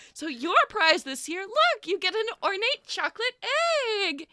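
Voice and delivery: chirpy festival host calling the crowd in; sugary village host, high and beaming; Lila the host. Acted. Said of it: "Lila's like".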